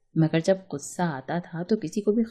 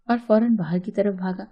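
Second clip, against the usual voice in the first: ordinary delivery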